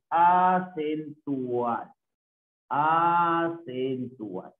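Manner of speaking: "acentual" drawn out syllable by syllable; teacher stretching "acentual" for note-taking; slow sounding-out. Shown as a demonstration. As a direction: dictate "acentual" very slowly, stretching the A. Teacher spells out term slowly.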